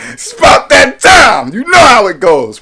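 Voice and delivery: high, booming pre-workout yell; hyped shout. Real, not acted. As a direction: shout it out, fired up and far above normal pitch.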